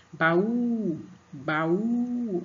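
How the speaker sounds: "Baú" called out, the "ú" held long; stress on last syllable. Shown as a demonstration. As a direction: open twice, pushing the "ú" of "Baú". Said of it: "o U é a sílaba mais forte".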